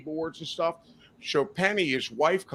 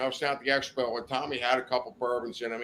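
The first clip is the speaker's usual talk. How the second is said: ordinary delivery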